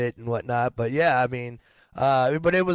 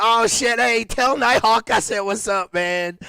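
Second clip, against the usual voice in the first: excited surprise; 8 semitones higher, 5 dB louder, slower than usual. Real, not acted. Excited reaction to a comment.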